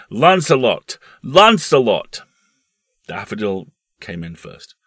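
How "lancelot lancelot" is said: loud, high cheer, drawn out on the repeat; excited yelling. Acted. Frenchman cheering his horse at the races, yelling. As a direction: yell for your horse, wild with excitement.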